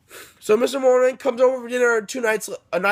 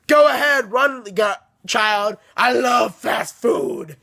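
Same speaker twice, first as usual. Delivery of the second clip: monster voice; slower than usual. Retold or imitated. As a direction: boom it out like a hungry monster, dragging the last line. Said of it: "And he says".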